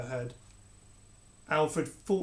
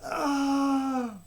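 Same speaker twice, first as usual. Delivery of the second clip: pained cry; 10 semitones higher, 6 dB louder than usual. Acted. Boy shoved away.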